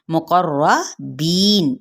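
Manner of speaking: मुक़र्रबीन slowly, with a full heavy ra; slow model reading of मुक़र्रबीन; recitation. Shown as a demonstration. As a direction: chant मुक़र्रबीन with a thick ra. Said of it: "रॉ पुर पढ़ा जाएगा".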